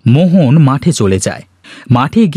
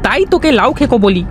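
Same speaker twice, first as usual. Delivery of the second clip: put-on high child voice, quick; a kid explaining the joke; teasing boy; 8 semitones higher, faster than usual. Rana explains the nickname to Joy, high and quick.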